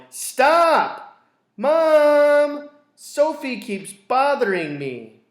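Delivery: put-on child shouting at his sister; yelling boy. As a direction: yell for Mom like an annoyed kid brother. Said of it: "Schubert yelled".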